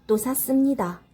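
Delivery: usual speaking voice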